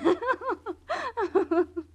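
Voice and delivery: put-on a bright, girlish giggle; light laugh. Young woman laughing as she tells her story. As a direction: laugh lightly, pleased with herself.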